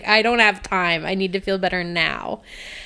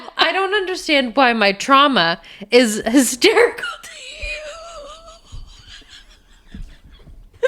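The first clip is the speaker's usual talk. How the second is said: laughing; 8 semitones higher than usual. Real, not acted. Mock indignation laughing.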